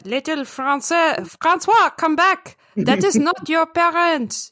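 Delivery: high, pleading French skunk; French accent. Acted. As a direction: call the little one back in a hammed-up French accent. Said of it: "the skunk had a French accent".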